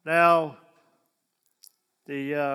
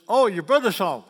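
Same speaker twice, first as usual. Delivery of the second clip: quoted servant; 3 semitones higher, 9 dB louder than usual. Retold or imitated. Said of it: "the servant says".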